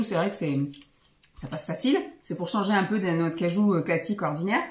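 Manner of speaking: ordinary delivery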